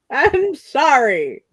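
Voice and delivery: talking through a yawn; slow, yawned apology. Real, not acted. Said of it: "You fucking yawn again".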